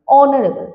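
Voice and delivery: অনেস্ট and অনারেবল said loud, vowel first, no h; silent h. Shown as a demonstration. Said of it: "উচ্চারণটা কি হচ্ছে". (এইচ নীরব রেখে জোরে উচ্চারণ)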